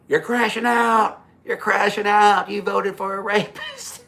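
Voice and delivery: high, loud, drawn-out taunt; re-enacted holler at a car pulling away; yelling after someone. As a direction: stretch each word into a mocking yell. Retold yelling at departing driver.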